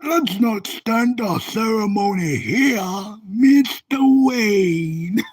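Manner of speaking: loud, slow, drawn-out villain impression; amateur supervillain voice, loud and very slow; Bane. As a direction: stretch it out grandly as a film villain. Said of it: "I can't do a good Bane impression".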